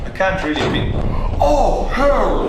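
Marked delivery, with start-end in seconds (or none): 1.4-2.5 much higher and louder burst of oh, hell; real wince of shock, shouted; shocked outcry. Real, not acted. Seeing a man hit hard, a real shocked yell. Reacts to painful crash.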